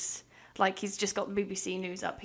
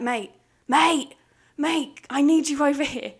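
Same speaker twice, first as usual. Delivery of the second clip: loud calling out across the office; boss shouting; 4 semitones higher, 7 dB louder than usual. Retold or imitated. Boss yelling for his employee to come over, loud. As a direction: bellow mate to get his attention.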